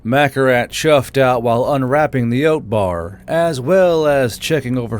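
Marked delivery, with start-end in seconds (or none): none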